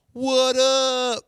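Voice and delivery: high, loud swaggering hype; mock-hyped player celebrating; cocky pitcher impression. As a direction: brag like a cocky young pitcher after a win. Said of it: "I don't think Imai says it like that".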